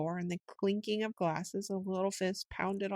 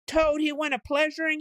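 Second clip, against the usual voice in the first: much higher, louder yell flung back at the singer; furious yell; 9 semitones higher, 6 dB louder than usual. A narrator's reading. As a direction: scream his own mocking song back at the weasel. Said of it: "he yelled".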